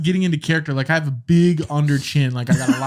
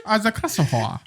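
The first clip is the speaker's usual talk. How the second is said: mock French accent, pitched up, laughing through it; hammed-up French accent, a little higher; 5 semitones higher than usual. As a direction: fumble a fake French accent with gibberish. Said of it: "make him do an accent".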